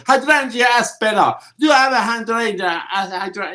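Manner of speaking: high, hammed-up Italian-style plant Latin; imitating a customer with an Italian accent; mock Italian accent. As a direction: ask for the plant in overdone Italian. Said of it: "If you go in and you say".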